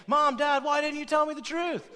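put-on whiny child calling out to mom and dad; mock hurt kid. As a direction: play the betrayed contestant asking their parents. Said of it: "the kid saying".

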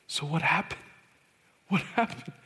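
halting question, rising on repeats; anxious questioning. Retold or imitated. Youth leader asking what happened to the little girl, anxious questioning. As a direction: ask haltingly, as if dreading the answer.

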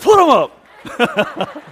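very slow, high, loud laughing shout; hammed-up fists-up dare; mock fight challenge. Pastor retelling his reaction to senior coffee offer, laughing shout. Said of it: "I was like".

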